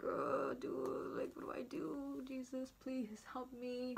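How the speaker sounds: hushed, desperate pleading, retold; holding back tears. Waiting in lobby trying not to cry, hushed plea. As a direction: plead under your breath, slowing at the end. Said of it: "trying so hard not to cry".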